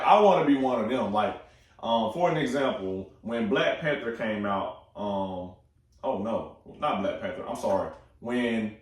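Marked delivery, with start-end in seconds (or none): none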